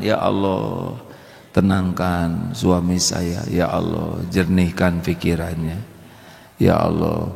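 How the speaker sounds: low, slow murmured prayer. Shown as a demonstration. Praying for husband, demonstrated.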